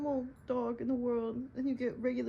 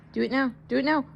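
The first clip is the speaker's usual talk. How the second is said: loud, firm dog command; 2 semitones higher, 7 dB louder, faster than usual. Real, not acted. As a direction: order the dog sharply.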